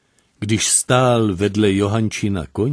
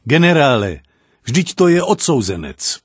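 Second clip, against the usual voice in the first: louder, worried warning to the general; anxious call; 5 semitones higher, 5 dB louder than usual. A narrator's reading. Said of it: "zvolal starostlivě".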